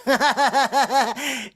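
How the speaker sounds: high, long staccato giggle; silly repeated ha-ha in a put-on voice; dorky laugh. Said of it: "he would stop being Elvis".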